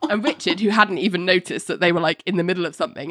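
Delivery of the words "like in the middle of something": ordinary talk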